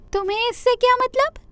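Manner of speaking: angry deer. Acted. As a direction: snap back as the hungry little deer. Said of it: "ہرن کو بہت غصہ آیا".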